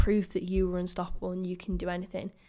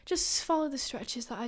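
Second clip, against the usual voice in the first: timid mumble; 6 semitones higher, 6 dB quieter than usual. Retold or imitated. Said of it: "I'm not like".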